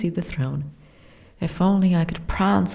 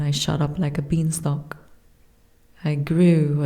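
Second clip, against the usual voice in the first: ordinary talk, nothing marked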